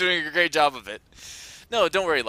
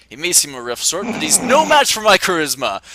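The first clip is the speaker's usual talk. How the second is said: building to a loud gloating boast; grand mad scientist swagger; slow theatrical villain menace; 5 semitones higher, 9 dB louder than usual. Acted. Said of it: "Does that sound like me".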